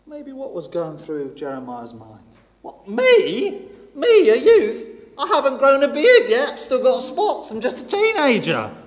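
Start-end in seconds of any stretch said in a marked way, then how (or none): 2.6-8.8 put-on much higher, louder squeaky-teen protest; a boy with spots who can't believe he's chosen; anxious teenage Jeremiah. Young Jeremiah doubting God's call.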